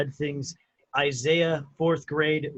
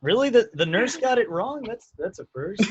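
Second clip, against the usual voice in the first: high, raised voice of surprise; amused disbelief; 8 semitones higher, 6 dB louder than usual. Real, not acted. Friend hearing the nurse got it wrong, amused disbelief. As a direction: question it in amused astonishment.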